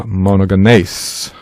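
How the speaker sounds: "monogenes" drawn out slowly as mono-geh-NACE; slow Greek "monogenes", last syllable long; Greek dictionary form. Shown as a demonstration. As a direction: say "monogenes" slowly with hard g, ending "nayss". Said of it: "this is its dictionary form".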